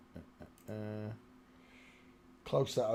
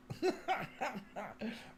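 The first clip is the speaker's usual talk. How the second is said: short, higher burst of laughter; 12 semitones higher, 6 dB quieter than usual. Real, not acted. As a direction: laugh at the likeness. Laughs at the lookalike.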